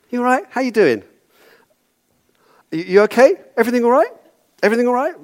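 high-pitched, falsely cheery small talk; breezily oblivious. Acted. Acting a doctor ignoring obvious injury.

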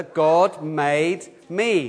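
very slow, every word spaced for all to join; slow recitation. Shown as a demonstration. Leading children and adults in saying it together, very slow. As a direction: lead the group, stretching each word. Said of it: "we're gonna say it all together".

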